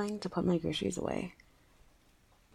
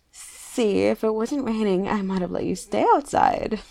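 playful teasing; 8 dB louder than usual. Acted. Teasing neighbor, louder.

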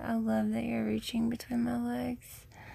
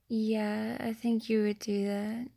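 ordinary talk

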